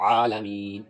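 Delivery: "عالمین" with a full pharyngeal ع at the start; ع brought out. Shown as a demonstration. Teacher repeats how it should be read, ع brought out. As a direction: draw out the throaty ع in "عالمین". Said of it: "عین نکال کر پڑھے".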